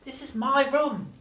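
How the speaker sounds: put-on a boy upset at the mess; boy's voice. A narrator's reading. George scolding Grandma, boyish protest. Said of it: "George said".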